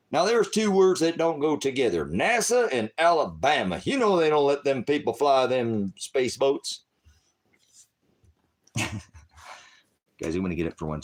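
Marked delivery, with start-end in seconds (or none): 0.1-6.8 mock yokel rant. Mocking redneck talk.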